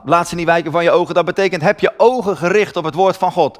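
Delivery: usual speaking voice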